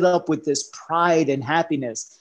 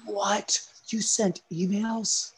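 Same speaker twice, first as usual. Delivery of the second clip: mock wife; 7 semitones higher, much slower than usual. Wife mocking the email answer.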